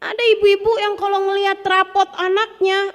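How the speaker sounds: usual speaking voice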